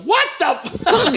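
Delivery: high, stretched-out screech without extra volume; mock scream. Imagining how other actresses would react, mock screaming. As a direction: imitate a screaming overreaction. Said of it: "Just screaming".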